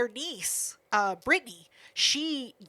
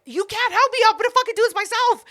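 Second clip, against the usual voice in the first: much higher, louder, fast; panicked outburst; 11 semitones higher, 6 dB louder than usual. Retold or imitated. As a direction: shove her help away in a panic. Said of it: "And he was like".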